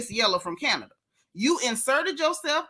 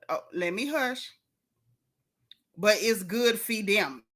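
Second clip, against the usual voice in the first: ordinary delivery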